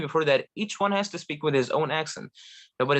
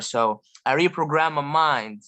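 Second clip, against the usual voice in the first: usual speaking voice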